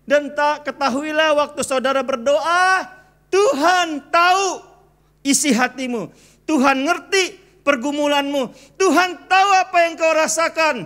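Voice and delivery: fervent sermon climax; full-force preaching. Acted. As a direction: preach it out to the congregation.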